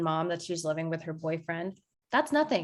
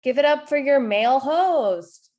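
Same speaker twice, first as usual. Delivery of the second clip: louder, higher jab thrown back at the host; loud teasing callout; 5 semitones higher, 7 dB louder than usual. Acted. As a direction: rib the emcee in front of the crowd. Comic ribs the host.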